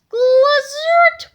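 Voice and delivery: loud zap noise; zap sound effect. Acted. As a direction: make the ray gun sound.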